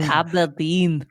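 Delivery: Aberdeen slow in a thick mock Scottish accent, letters dropped; hammed-up Scottish accent. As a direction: drag out Aberdeen like a broad Scot. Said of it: "you're leaving out letters and everything".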